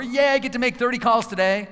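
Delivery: high, loud mock excitement; fake cheer. Shown as a demonstration. Coach mocking forced positivity about carrots, hammed-up cheer. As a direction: overdo it like a cheerleader. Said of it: "I don't want you to go".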